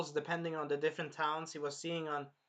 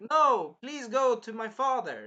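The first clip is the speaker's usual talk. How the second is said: loud, high, drawn-out plea; slow, raised-voice mock protest; mock pleading; 6 semitones higher, 7 dB louder, slower than usual. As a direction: imitate a princess begging insistently. Acts out Melty pleading with Naofumi.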